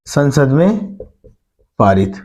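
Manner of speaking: two chunks with a long pause between; dictation. Real, not acted. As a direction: dictate it piece by piece for note-takers. Teacher dictates point to write down.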